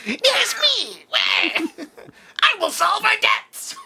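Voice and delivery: high, slow gleeful goblin cry; shrill hammed-up goblin speech; goblin president. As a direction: proclaim it like a triumphant little goblin.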